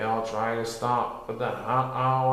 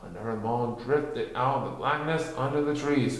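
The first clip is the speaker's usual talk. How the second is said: plain reading voice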